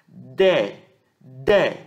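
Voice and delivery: "De" said twice apart, with open E; open vowel demo. Shown as a demonstration. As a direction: sound out De as a wide DÉ, each one separate. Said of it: "ela é lida aberta".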